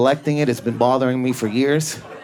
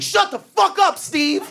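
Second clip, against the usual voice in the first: high yelled put-down; mock yell; slower than usual. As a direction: bark it at the heckler, hammed up. Comics yelling at Steve.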